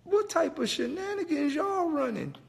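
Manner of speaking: high squeaky indignant question; high-pitched incredulous demand; mock outrage. Real, not acted.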